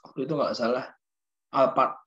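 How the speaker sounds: ordinary delivery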